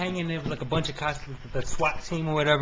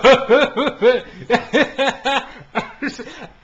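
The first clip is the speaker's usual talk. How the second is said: loud, high burst of laughter; real belly laugh; 8 semitones higher, 8 dB louder than usual. Real, not acted. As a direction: crack up at your own absurd question. Cracks up at own question.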